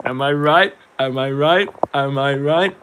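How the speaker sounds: loud, high, rising am I right; rally the crowd with a hammed-up shout; mock hype call. Streamer hypes chat with a hammed-up loud, high call. Repeated loud rhetorical question.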